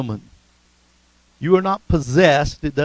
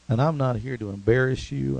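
usual speaking voice

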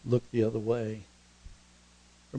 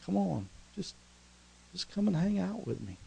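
soft, higher-pitched gentle plea; coaxing invitation; 6 semitones higher, 5 dB quieter than usual. Acted. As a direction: coax a friend kindly to stay awhile. Jesus warmly coaxes to join him.

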